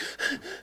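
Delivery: acted breathless gasps; panting. Recruit skidding into squad bay, imitated gasping. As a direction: pant as if you just fled. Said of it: "I was just like".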